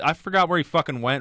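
usual speaking voice

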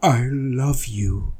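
soft and slow; quiet. A narrator's reading. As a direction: say it softly and slowly. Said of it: "I said quietly".

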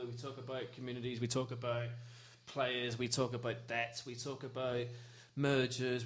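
a sincere club statement, soft and proud; heartfelt formal speech. Acted. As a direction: speak it like a proud tribute, slowing on key lines. Heartfelt speech about Grenfell support.

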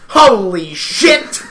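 loud, slow, drawn-out amazement; retold amazed reaction. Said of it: "looks back and goes".

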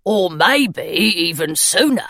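slow, hopeful gargoyle voice; the gargoyle teasing with a glimmer of hope; gargoyle, slowly hopeful. Acted. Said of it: "the gargoyle said hopefully".

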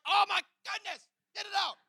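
high, loud cry of alarm; panicked shout. Acted. Patient to doctor as water rushes into his ears, panicked shout.

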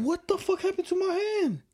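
jolted by icy water, voice shoots up high; shocked squeal. Real, not acted. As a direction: squeak it out in real disbelief.

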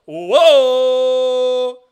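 loud high startled whoa; surprised whoa. Real, not acted. Host startled on camera, loud high whoa. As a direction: blurt it out in surprise.

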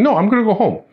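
retold arguing refusal. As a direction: re-enact digging your heels in during an argument. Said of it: "So I'm fighting with him".

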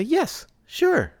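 very high, strained yes; forced cheerful yes. Acted. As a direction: sound trapped but agreeing.

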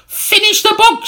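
very high, loud shriek; shrill demand. Acted. Old woman repeats her order, very high loud shriek. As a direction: scream the order at him. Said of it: "she said again".

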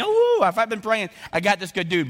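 high, loud gleeful cheer; excited inner thought. Pretend. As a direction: cheer like you finally got your prayer answered.

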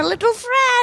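little dog voice. Acted. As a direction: play the small sidekick pet. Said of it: "She keeps changing voices".

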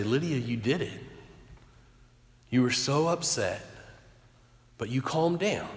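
soft, gentle praise; tender reassurance. Retold or imitated. Father with arm round his daughter, tender soft praise. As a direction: praise an upset child softly, with pauses.

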